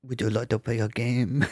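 mock creepy European accent. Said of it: "she tried to do a creepy voice with her European accent".

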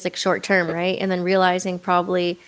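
ordinary talk

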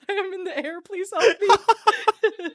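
high, drawn-out fake distress through laughter; badly faked falling plea, giggling; laughing mock plea. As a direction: beg for help while cracking up.

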